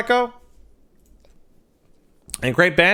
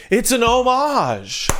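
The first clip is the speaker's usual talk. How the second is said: drawn-out exclamation; 9 semitones higher, slower than usual. Acted.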